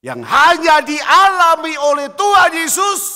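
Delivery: loud, high cry from the pulpit; loud, high preaching burst; full-force preaching. Acted. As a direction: preach it out at the top of your voice.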